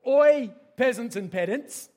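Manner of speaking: big, loud proclamation; mock herald's shout. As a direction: holler it at the common folk. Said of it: "So now we've got".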